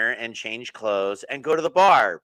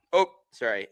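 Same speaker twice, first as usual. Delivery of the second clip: usual speaking voice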